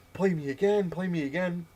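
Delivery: high, loud imitation of a demanding kid; nonstop pestering. Kid badgers the winner for a rematch, mock insistent. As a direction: nag insistently, mimicking a sore loser. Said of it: "just nonstop".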